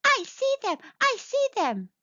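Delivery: high-pitched, eager little cry; excited little mole. A narrator's reading. Little mole answers mamma, crying out high and eager. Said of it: "cried Little Mole".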